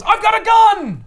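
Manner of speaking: high, loud mock shout; hammed-up loud boast. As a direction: bark it like a tough guy showing off. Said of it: "someone else goes".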